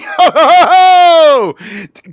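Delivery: big high burst of laughter; loud laugh. Real, not acted. Cohost cracks up at the jab, loud laughing.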